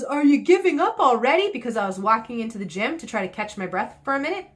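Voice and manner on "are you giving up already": mock taunt. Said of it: "pulls me into him and says".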